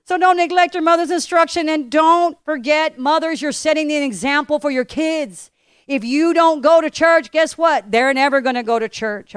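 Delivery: loud, emphatic preaching; strong pulpit voice. Acted. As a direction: press it on the mothers. Pastor preaching to congregation.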